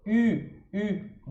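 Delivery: U with puckered lips, close to "i"; French U. Shown as a demonstration. Teacher introducing the last vowel, puckered French U. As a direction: pout and say a fronted U. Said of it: "de novo aqui o biquinho".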